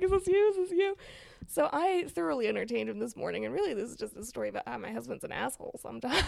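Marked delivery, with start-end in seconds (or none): 0.0-0.9 teasing husband. Retold or imitated. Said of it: "he's like".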